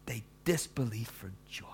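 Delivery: soft whisper of the phrase. Shown as a demonstration. Preacher savoring the verse for listeners, whispered. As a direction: hush it as if in awe. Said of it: "interesting turn of phrase".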